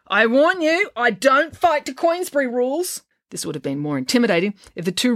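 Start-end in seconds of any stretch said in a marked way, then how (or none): 0.1-3.0 high, feisty warning; girl's threat. Acted. Injured girl squaring up to the father, high threatening warning. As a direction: warn a grown man off, pitched high.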